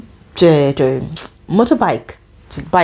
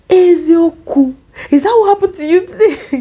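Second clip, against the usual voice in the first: very high, louder mock astonishment; shocked disbelief; 13 semitones higher, 4 dB louder than usual. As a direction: imitate a stunned manager, drawing out the first word. Igbo manager's shocked reaction, repeated.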